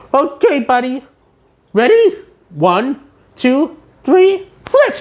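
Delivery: rising high cartoon count; SpongeBob counting. SpongeBob counting down for spatula, climbing high. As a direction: build to a high squeaky flip.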